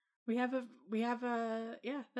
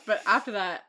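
ordinary talk

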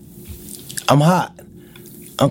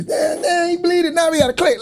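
high, startled mock panic; alarmed exclaim; 12 semitones higher than usual. Retelling his friends after a knockout, high and startled. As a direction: blurt it out like you just saw blood. Retold reaction after a fight.

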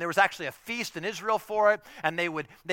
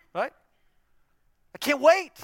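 put-on high, louder kid's gush; excited kid; 9 semitones higher, 7 dB louder than usual. Child telling parents about Disneyland, high excited gush. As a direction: burst with a child's eagerness.

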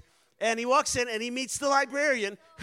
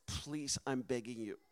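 much softer and lower, pleading; a hushed, desperate plea; mock whisper; 7 semitones lower, 6 dB quieter than usual. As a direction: lean in and beg under your breath. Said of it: "whispers in the missionary's ear".

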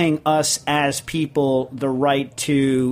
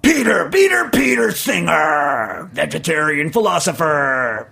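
hammed-up introduction; 3 dB louder, slower than usual. Host mock-introducing a philosopher on air, hammed-up announcer shout. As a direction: announce a name like a showy ring announcer. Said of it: "Peter is gonna do the introduction".

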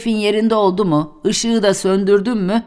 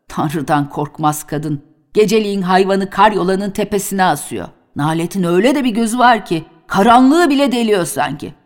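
ordinary delivery

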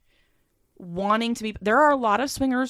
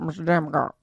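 low, slurred mock southern twang; playful southern accent; 4 semitones lower than usual. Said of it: "in my southern accent twang".

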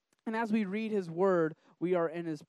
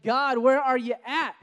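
desperate cry to God; 6 semitones higher, 7 dB louder than usual. Retold or imitated. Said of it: "you're just like".

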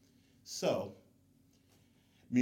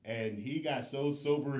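normal talking voice

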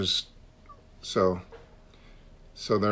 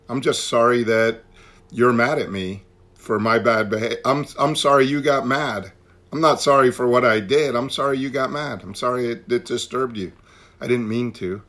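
louder, defensive-sounding mock apology; mock non-apology; 3 semitones higher, 6 dB louder than usual. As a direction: imitate someone excusing themselves insistently. Acting out his insincere apology.

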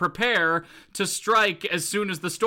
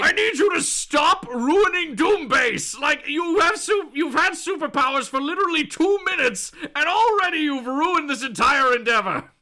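exasperated Doom; 8 semitones higher, 3 dB louder than usual. Acted. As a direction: yell a furious supervillain's telling-off. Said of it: "To which Doom responds".

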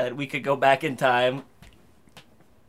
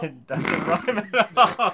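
laughing; 5 semitones higher, 6 dB louder, much slower than usual. Real, not acted. Laughing at the bad poem.

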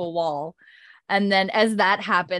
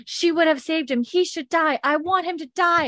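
higher, hammed-up furious rant; imitated fury; 9 semitones higher than usual. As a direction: play the wife seething that he must die. Beardsley enraged at Claire, retold.

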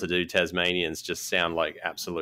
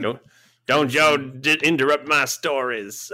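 put-on Southern US accent; 7 semitones higher, 4 dB louder than usual. Granny in the lounge room watching her programs, Southern US accent. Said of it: "that's a good accent".

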